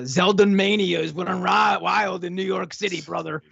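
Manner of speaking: lightly voiced pro-wrestler hype; a hint of wrestler bravado; mock wrestler promo. Wrestling promo parody of political hype.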